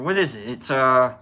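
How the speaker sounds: ordinary talk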